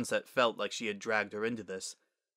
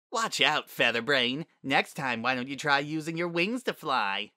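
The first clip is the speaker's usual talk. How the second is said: higher, jeering unicorn bully; taunting school bully; 4 semitones higher than usual. Acted. Bully mocks a fallen classmate in the cafeteria, taunting. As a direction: jeer at the one you tripped.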